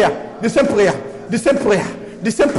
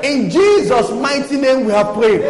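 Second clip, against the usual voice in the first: loud, declaring, shouted prayer; fiery warfare prayer; 2 semitones higher, 4 dB louder than usual. Acted. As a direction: rebuke it with full preaching force.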